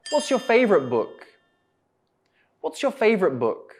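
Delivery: starts high, drops down to "book"; falling question intonation. Shown as a demonstration. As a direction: let the voice fall away on "book". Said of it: "it goes down".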